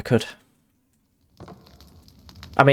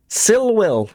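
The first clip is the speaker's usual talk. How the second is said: high retake; 10 semitones higher than usual. Acted. Repeat, even higher.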